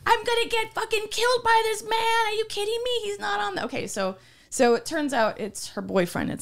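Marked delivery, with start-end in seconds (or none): 0.0-3.6 high, shrill-edged screaming at the cops; angry woman yelling. Retold or imitated. Neighbor woman yelling at the cops, furious. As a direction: reenact the neighbor yelling at police. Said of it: "and she's like".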